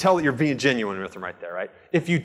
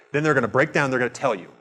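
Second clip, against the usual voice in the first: usual speaking voice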